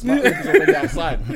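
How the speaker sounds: laughing. Real, not acted. Laughing at his own line.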